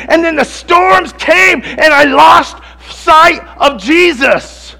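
loud, high impassioned sermon climax; fiery preacher in full flight; preaching at full force. Acted. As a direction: preach it out over the storm.

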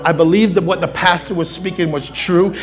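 building to a long shouted peak; build, then roar the last words slowly; preaching at full force. Acted. Preaching climax.

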